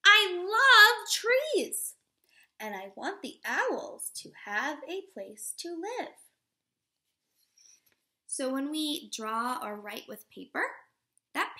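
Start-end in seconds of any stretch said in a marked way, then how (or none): 0.0-6.1 slow, expressive storybook read-aloud; reading to children. Acted. Teacher reading a picture book to children, expressive read-aloud. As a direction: read it warmly to a class of little kids.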